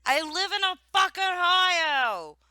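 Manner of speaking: high, loud protest; worked-up, twangy outburst; exasperated shout. Retold or imitated. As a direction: yell it in indignant self-defense. Acting out her protest at redneck jibes.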